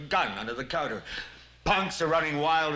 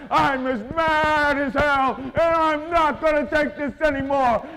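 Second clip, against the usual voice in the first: slow, much higher and louder cry; full yell; 10 semitones higher, 7 dB louder, slower than usual. Shown as a demonstration. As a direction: bellow it with everything. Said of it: "You've got to say".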